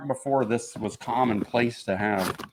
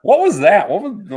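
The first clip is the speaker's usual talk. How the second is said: ordinary talk, nothing marked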